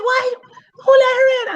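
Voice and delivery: put-on high, indignant girlfriend voice; mock shrill demand. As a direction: play the other woman, outraged. Other woman outraged.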